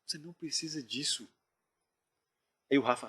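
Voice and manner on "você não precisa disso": retold hushed plea to a friend; very quiet warning. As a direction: urge him softly, keeping it under your voice. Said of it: "falando muito baixo".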